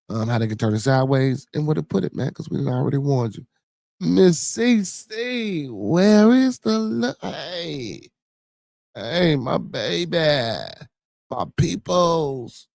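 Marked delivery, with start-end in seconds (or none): 4.0-12.7 high, slow, stretched greeting; drawn-out greeting. Real, not acted. Greeting viewers in the chat, high and stretched. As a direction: sing the names out slowly.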